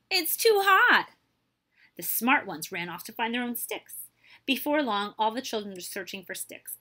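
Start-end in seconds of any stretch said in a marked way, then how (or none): 0.1-1.1 high, loud, drawn-out yelp; yelped complaint. A narrator's reading. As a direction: wail it loudly, slowly. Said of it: "they yelped".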